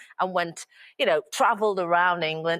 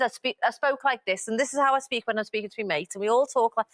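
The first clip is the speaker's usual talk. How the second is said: thick Scouse, lilting up and down; Scouse as spoken to your mates; stronger Scouse; 5 semitones higher than usual. Shown as a demonstration. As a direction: lean into your Liverpool accent, higher and singsong. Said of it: "start going more and more Scouse".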